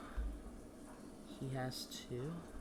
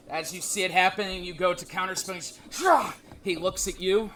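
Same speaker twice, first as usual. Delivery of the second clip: game master sets the scene; dramatic narration; 5 semitones higher, 6 dB louder than usual. A narrator's reading. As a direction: build the tension as he raises his hand.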